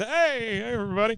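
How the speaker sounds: high-pitched mock greeting; Bill. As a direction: imitate someone naively waving hello at a loudspeaker. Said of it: "just starts waving".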